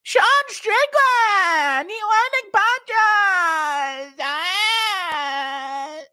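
very high, drawn-out wail; high-pitched commentary cry. Acted. Impersonating a fight commentator, high drawn-out cry. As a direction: stretch each word into a slow, high scream.

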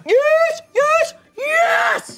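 high excited shrieks. Real, not acted. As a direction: scream with triumph. Celebrating a card.

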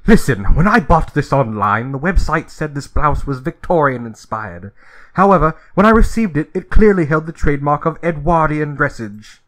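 a hint of a prim British gentleman complaining; snooty British. Customer complaining to the cashier, lightly snooty British accent. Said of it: "like, a snooty British person".

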